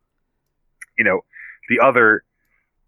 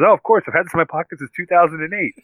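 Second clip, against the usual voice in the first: proud Happy; 8 semitones higher than usual. Retold or imitated. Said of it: "Happy pulls it out".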